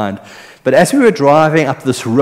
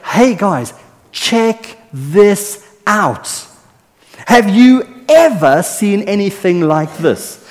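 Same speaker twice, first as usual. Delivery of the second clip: high, slowed, urgent calling out to friends; John, eager and excited; 7 semitones higher, 3 dB louder, slower than usual. Pretend. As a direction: grab the listeners' attention like an excited friend. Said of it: "John would be saying to us".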